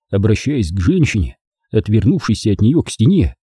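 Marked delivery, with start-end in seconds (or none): none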